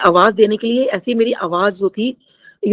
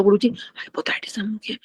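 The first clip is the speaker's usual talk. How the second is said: barely audible whisper, as if the voice is gone; voiceless whisper; 10 dB quieter than usual. Retold or imitated. Devotee with lost voice trying to tell Guruji, voiceless whisper. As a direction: force out words with no voice. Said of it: "आवाज तो निकल नहीं रही बिल्कुल भी".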